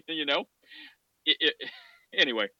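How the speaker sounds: usual speaking voice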